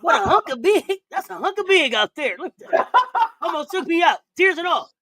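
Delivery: fighting back real laughter; trying not to laugh. Real, not acted. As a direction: keep talking while laughter leaks through. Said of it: "I'm trying not to laugh".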